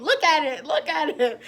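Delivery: mock pleading shout. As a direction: hold it up and demand they look. Pleading with passersby, acted out.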